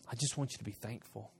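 very soft, low voice; hushed, settled correction as God; calm divine reply. Acted. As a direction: answer a tantrum with gentle patience. God's calm answer to the tantrum.

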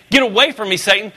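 ordinary talk, nothing marked

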